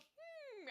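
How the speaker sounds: soft, high "hmm"; puzzled hum. Retold or imitated. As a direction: hum quietly, as if something is off. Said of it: "And I still go".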